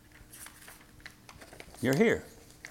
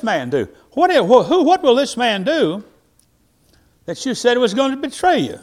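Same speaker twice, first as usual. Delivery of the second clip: Peter's eager question; 5 semitones higher, 6 dB louder than usual. Acted. Peter voiced asking Jesus.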